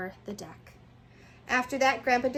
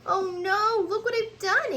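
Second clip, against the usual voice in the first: high, loud gasping dismay; gasped dismay; 10 semitones higher, 6 dB louder, slower than usual. A narrator's reading. Angelina seeing her ruined costume, gasping. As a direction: gasp it out in horror. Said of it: "Angelina gasped".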